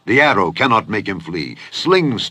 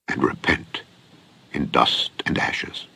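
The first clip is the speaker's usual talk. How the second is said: whispered repentance; 6 semitones lower, 7 dB quieter than usual. A narrator's reading. Job humbly confessing to the Lord, whispered. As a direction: voice sinks to a low, breathless whisper.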